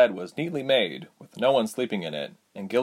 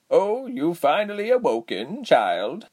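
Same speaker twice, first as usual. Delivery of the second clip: raspy character voice, pitched up; 9 semitones higher, slower than usual. A narrator's reading. Giselle greeting the newly woken Reshma, raspy. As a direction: rasp it out with drawn-out vowels. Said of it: "replied with a raspy voice".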